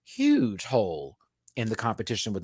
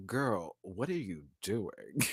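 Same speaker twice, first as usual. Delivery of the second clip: lightly voiced shady friend, lower and softer; a hint of shady Minty; 6 semitones lower, 11 dB quieter, slower than usual. Imagining Minty throwing shade, low and soft. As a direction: drop it low with side-eye. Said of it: "I can hear Minty right now".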